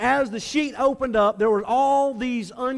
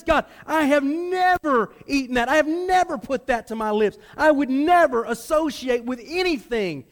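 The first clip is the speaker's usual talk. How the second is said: higher and louder, stress on never; indignant protest; 4 semitones higher, 3 dB louder than usual. Acted. Peter protesting to God, indignant and loud. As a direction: insist on your purity, offended. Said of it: "He would protest this".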